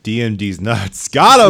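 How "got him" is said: high, loud victorious crow; loud, high gotcha after a dirty joke; triumphant gotcha. Acted. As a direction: shout it like you just scored.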